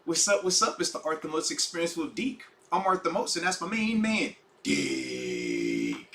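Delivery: quiet, distant broken mic; far-off tinny audio. Real, not acted. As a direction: intro through a bad microphone. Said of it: "So we sound far away".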